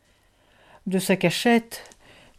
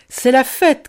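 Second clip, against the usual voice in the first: raised, festive shout; crying out; 5 semitones higher, 7 dB louder than usual. A narrator's reading. Boustru bursting in on the children, crying out. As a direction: announce it loudly to everyone. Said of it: "cria-t-il".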